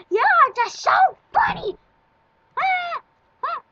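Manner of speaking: very high, loud delighted squeal; giddy squeal. Real, not acted. Giggling at own drawing.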